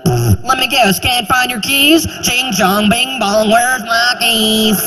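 high, slow taunting singsong. Pretend. Locksmith taunting a Korean man, slow high mockery. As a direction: sneer and mock him slowly. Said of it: "he'd be like".

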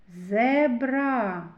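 зебра with the last a drawn out; stretched final a. Shown as a demonstration. Teacher picking out the last vowel, long a in зебра. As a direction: hold the ending a of зебра. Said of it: "А голосний".

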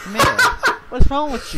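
laughing, shrill pretend coo; mock high voice. Real, not acted. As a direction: squeal it like a cartoon sweetheart.

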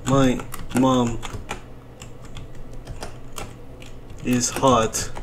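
low, halting put-on voice; slow low character voice; imitating his talk. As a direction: say it deep with long pauses. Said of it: "This is how he talks".

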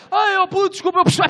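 high, squeaky apology; sheepish Neves. Retold or imitated. Neves apologizing in a high squeaky voice. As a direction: play the caught-out friend. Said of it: "olha para esta merda".

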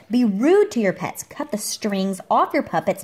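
ordinary delivery

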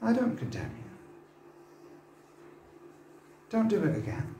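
a mild, soft word of pardon; gentle quiet teacher. Retold or imitated. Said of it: "this religious teacher say".

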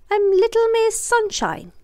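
put-on sweet little girl. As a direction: pretend to be sunny and sweet. Miss Contrary lying about name.

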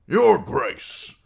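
high, loud, oily flattering greeting; fawning lord. Acted. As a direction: grovel loudly to the king. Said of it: "Lord Janos Slynt clearing his throat".

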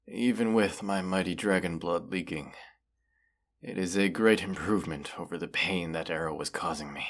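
ordinary delivery

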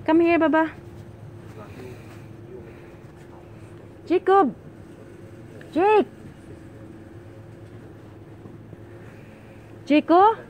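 calling out. Real, not acted. Calling child in the store.

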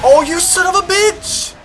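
slow, high indignant yell; playful fury at getting hit; mock outrage. Real, not acted. Hit by other player.

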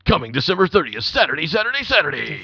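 booming hype announcer, high and loud; fighting game announcer. Acted. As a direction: shout it like a fight night promo. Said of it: "like, announcer voice right now".

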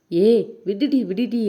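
mildly shouted protest in a story; narrated shout. A narrator's reading. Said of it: "Poovayi கத்தினாள்".

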